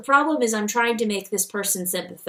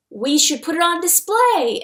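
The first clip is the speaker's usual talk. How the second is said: put-on high, louder kid voice; excited child's suggestion; eager boy; 7 semitones higher, 4 dB louder than usual. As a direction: pitch it up like a boy with an idea. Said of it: "I feel like Dib would have".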